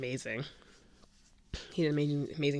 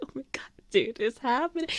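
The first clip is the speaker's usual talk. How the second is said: breathy start climbing higher; hushed excitement; 8 semitones higher, faster than usual. Retold or imitated. Fan to her friend as crowd goes wild, breathless excitement. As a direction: build from gasp toward squeal.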